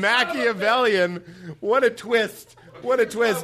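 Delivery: amused surprise. Real, not acted. Guest marvelling at his own trap. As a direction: marvel at the plot twist.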